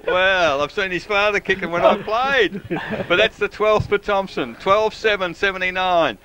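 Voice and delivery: elated score update; excited after goal. Real, not acted. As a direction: keep the goal's excitement going. Celebrating the goal just scored.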